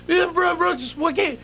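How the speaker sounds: high frantic stammering; panicked. Retold or imitated. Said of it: "She says".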